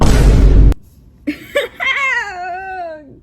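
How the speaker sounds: loud, high burst of laughter; loud laughing burst. Real, not acted. Reader loses it at the fanfic, laughing hard. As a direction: crack up helplessly and loudly.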